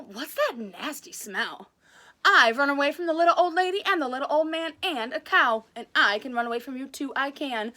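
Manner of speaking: lively read-aloud storytelling voice; animated children's story reading; story narration. A narrator's reading. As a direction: read it like a picture book to kids.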